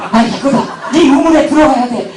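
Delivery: high-pitched, eager mock inner cry; hammed-up eureka, pitch jumping up; excited realization. Preacher replaying his eager inner thought to the congregation, excited.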